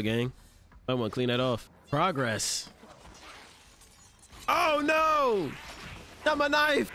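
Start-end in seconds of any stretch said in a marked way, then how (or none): 4.5-6.9 high, wailing outcry; mock dismay. Viewer speaking for the fighter losing a knife, mock dismay. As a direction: cry out high in playful alarm.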